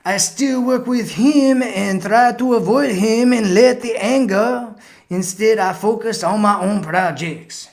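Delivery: high, hammed-up French accent; butchered French accent. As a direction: read it out in a bad fake French accent. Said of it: "this is probably my butchered French accent".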